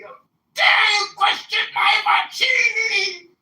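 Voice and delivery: high, loud, slow mock-English cry of outrage; mock outraged English shout. Mocking the man's offended defence with a slow, wailing English shout. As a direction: wail it like an offended English gent. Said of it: "mocking him in my English accent".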